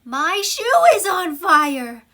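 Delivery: high, loud and slow cry of alarm; alarmed exclamation. Shown as a demonstration. Teacher acting out an exclamation for the class, high and loud. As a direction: shout it like your shoe is really burning. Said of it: "They always end with an exclamation point".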